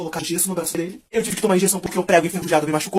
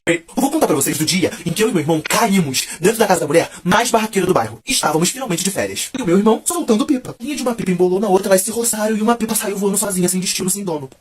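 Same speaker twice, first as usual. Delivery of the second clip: usual speaking voice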